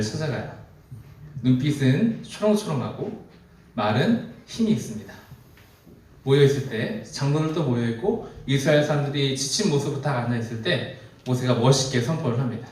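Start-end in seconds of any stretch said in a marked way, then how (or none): none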